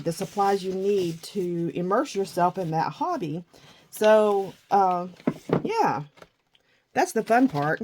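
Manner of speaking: ordinary delivery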